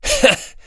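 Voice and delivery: a light amused laugh; soft chuckle. A narrator's reading. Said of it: "But Komaeda smiled".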